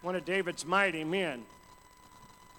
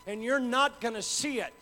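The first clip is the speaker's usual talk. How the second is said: loud, rising preacher's call; full-force preaching; 5 semitones higher, 7 dB louder than usual. Acted. Preacher driving the congregation toward God with full force. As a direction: build the sermon to a roar.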